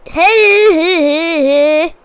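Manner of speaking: mocking, drawn-out hey; silly guy's voice. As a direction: put on a goofy talker's voice. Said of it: "What kind of guy talks like this?".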